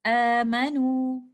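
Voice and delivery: slow chanted Amanu with a two-count mad; Quranic recitation. Shown as a demonstration. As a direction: intone Amanu in recitation voice, stretching its opening alif. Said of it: "we will recite it like this".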